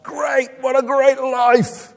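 slow, mock-cheerful "Great, what a great life"; mock triumph. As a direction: imitate a hollow boast of triumph. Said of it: "not with the great triumph".

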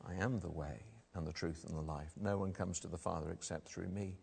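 low, soft, measured reply; calm assurance. Acted. Jesus answering Thomas about the way, calm and assured. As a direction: settle the question quietly and surely.

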